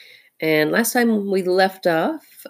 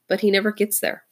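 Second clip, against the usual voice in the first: usual speaking voice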